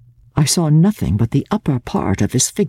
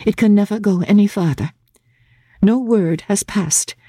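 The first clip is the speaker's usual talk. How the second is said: put-on higher, gentle young woman's voice; Laura's voice; 8 semitones higher than usual. A narrator's reading. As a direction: voice Laura, trembling with resolve.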